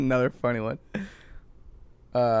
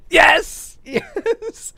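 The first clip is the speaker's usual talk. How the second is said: high laughing yes; excited cheer; 4 dB louder than usual. Real, not acted. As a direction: cheer with delight. Got the word right.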